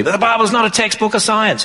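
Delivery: high-pitched imitation of a challenger; mimicked objector. Man challenging the speaker at a university, mimicked objection.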